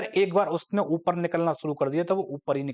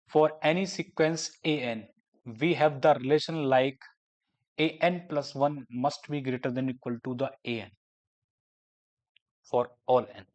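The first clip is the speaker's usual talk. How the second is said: slow, word by word dictation pace; much slower than usual. Shown as a demonstration. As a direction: dictate it so students can write it down. Dictating a definition slowly. (धीमे-धीमे लिखवाने वाला अंदाज़)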